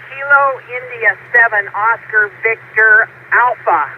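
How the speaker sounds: slow, deliberate spelling out; careful unhurried voice. Real, not acted. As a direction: spell each word out slowly. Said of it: "let me say this slowly".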